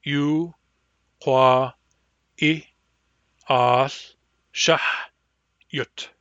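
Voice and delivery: each syllable said apart with pauses; syllable-by-syllable drill. Shown as a demonstration. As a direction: split it into separate syllables. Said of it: "By syllables".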